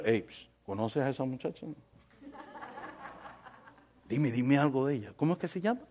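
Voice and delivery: hushed, low, furtive inquiry; discreet asking. Acted. Preacher acting someone asking a friend about a girl, hushed. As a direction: act out quietly fishing for information. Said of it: "Usted le pregunta a otra persona".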